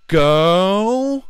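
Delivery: put-on high, loud stretched Girl; drawn-out girl. Imitating a coworker gushing over dudes, high and stretched. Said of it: "kept going".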